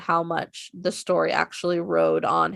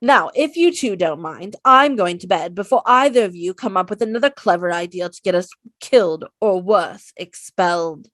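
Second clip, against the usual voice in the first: bossy, louder Hermione scolding; Hermione impression; 8 dB louder than usual. Hermione scolding the boys before bed, prim exasperated. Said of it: "my Hermione quote".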